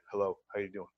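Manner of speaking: deep, low phone greeting; low-pitched voice. Shown as a demonstration. Man answering the phone to a prospect, low-pitched. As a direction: drop way down for the hello. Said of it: "if you have a low-pitched voice".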